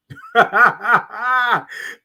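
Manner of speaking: high, loud run of ha-ha laughter; hearty laugh. Real, not acted. Host tickled by own shirt, laughing out loud. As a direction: burst out laughing.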